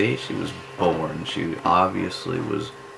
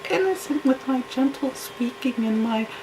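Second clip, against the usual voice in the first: high, breathy-sweet mock falsetto of a fake innocent woman; mocking the wife's fake innocent sweetness in a high voice. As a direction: sneer as you imitate her sugary gentle voice. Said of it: "she don't fall for her".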